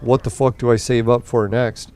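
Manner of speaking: normal talking voice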